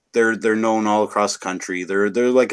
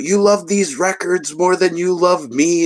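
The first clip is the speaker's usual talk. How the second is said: imitating an upset girlfriend, higher pitched; retold lovers' quarrel, voice pitched up in mock outrage; freaking-out girlfriend; 9 semitones higher than usual. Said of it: "the girlfriend's freaking out".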